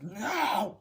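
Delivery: high, drawn-out imitated protest; hammed-up kid's no; mock refusal. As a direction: whine it out like a refusing child. Acting Michael refusing to stay.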